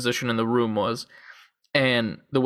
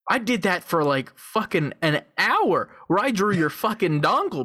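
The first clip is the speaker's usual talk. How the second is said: high-pitched, exasperated protest; mock indignant comeback; 6 semitones higher than usual. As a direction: argue back in comic outrage. Acted comeback to offended model.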